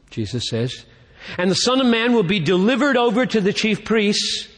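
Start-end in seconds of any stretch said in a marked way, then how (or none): 1.4-4.5 high-pitched, declamatory Bible reading; Scripture proclamation. Acted. Preacher reads prophecy to the congregation, proclaiming in a high pitch. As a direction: proclaim the verse in a raised pitch. Recites Jesus's prophecy of his death.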